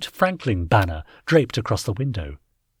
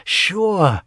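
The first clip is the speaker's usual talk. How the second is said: sighed; 8 semitones higher, 3 dB louder than usual. A narrator's reading. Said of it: "Tinkler sighed".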